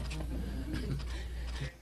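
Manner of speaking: a quiet breathy chuckle; a small fond laugh, barely voiced; soft breathy laugh. Real, not acted. Laughs after recalling wife's joke.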